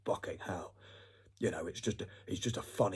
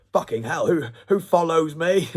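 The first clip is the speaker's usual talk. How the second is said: loud, higher, stretched-out disbelief; mock amazed; 9 semitones higher, 16 dB louder than usual. YouTuber stunned by a single new follower, loudly excited. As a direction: gasp it out like it's the best news ever. Thrilled at getting one follower.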